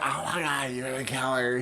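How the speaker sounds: quietly horrified; hushed realization. Retold or imitated. Sydney realizing Billy is killer.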